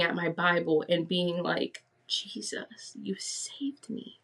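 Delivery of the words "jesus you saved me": slow, hushed whisper of gratitude; retold breath-only prayer of thanks; awed whisper. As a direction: whisper it slowly, as if stunned. Retold awestruck words to Jesus.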